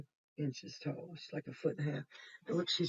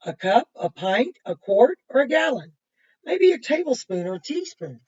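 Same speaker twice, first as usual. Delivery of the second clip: bright, louder storybook read-aloud; reading to children; 3 semitones higher, 11 dB louder than usual. Acted. Reading a picture book to kids, bright and lively. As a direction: read it out lively for the little ones.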